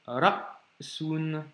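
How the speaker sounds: ra'sun, very slow, complete stop after ra before sun; slow Arabic reading model; full glottal block. Shown as a demonstration. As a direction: cut the breath hard in ra'sun. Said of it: "blocage complet".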